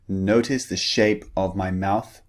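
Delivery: usual speaking voice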